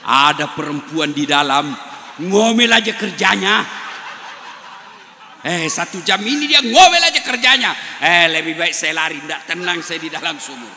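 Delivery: loud laughing complaint; fed-up jinn venting loudly; exasperated jinn. Acted. Jinn complaining loudly about the nagging wife.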